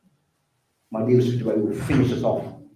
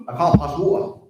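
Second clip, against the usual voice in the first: son's excuse; 5 semitones higher, 3 dB louder than usual. Retold or imitated. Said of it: "That's what he's saying".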